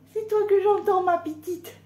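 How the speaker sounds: high, fond sing-song to a hen; cooing pet voice. Acted. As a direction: coo at the little one.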